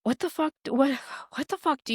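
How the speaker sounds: retelling her own disbelief with the pitch pushed up; exasperated outburst. Retold or imitated. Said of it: "And I was like".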